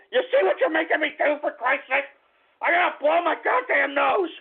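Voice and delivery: high-pitched, aggrieved yelling; angry outburst. Real, not acted. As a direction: blame the listeners, voice climbing high. Blames listeners, repeats it angrily.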